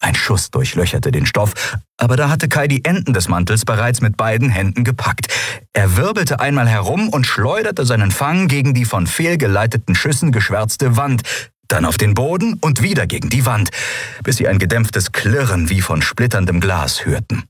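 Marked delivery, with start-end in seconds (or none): none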